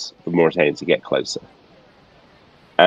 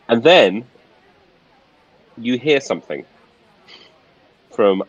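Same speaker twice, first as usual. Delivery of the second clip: ordinary delivery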